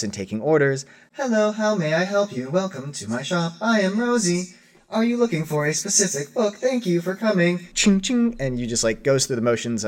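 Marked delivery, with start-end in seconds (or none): 1.1-8.4 high robotic shop droid voice; mechanical. Acted. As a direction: recite it like a scripted android cashier. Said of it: "much more mechanically than Rosie normally moves".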